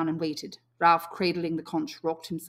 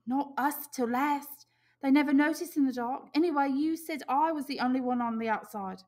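put-on defensive boy; 9 semitones higher than usual. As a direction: insist it was nothing. Piggy voiced high.

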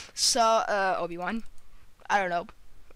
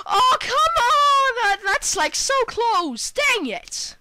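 high, loud wail of exasperation; frustrated outburst; 11 semitones higher, 6 dB louder, slower than usual. Real, not acted. Gamer to himself after a near miss, frustrated wail. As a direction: cry out in real dismay at a near miss.

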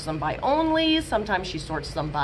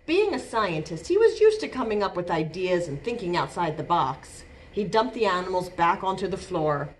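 gentle picture-book narration; reading to children; 4 semitones lower than usual. A narrator's reading. Librarian reading a picture book to kids, storytelling voice. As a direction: read it like story time for little ones.

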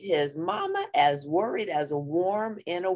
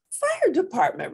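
put-on girl voice; 3 dB louder than usual. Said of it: "repeated Jilly Boo".

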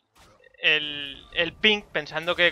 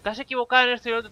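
mock critic voice; 7 semitones higher, much faster than usual. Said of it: "me lo habéis dicho mucho".

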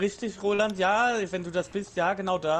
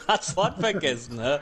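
laughing. Real, not acted. Laughing at the forgotten word.

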